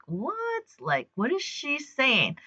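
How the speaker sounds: high, soft, baffled voice; mock bewilderment. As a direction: imitate a puzzled onlooker, hushed and high-pitched. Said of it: "she's looking at me like".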